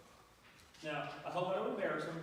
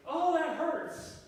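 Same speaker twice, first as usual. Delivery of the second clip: high, drawn-out slow pained whine; 8 semitones higher, 5 dB louder, much slower than usual. Retold or imitated. Man in the fable whining so everyone backs off, slow and high. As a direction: stretch the complaint out to get sympathy.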